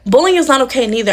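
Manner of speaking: ordinary talk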